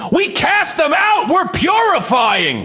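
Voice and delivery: high, hammed-up zealous yell; shouted self-righteous declaration; defiant mock shout. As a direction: proclaim it at a high-pitched scream. Acted defiant response of the church.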